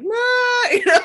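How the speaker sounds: high, loud crying laugh breaking out; a sob that tips into a laugh. Real, not acted. Mother in the anger workbook, crying then laughing. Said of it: "bawling my eyes out".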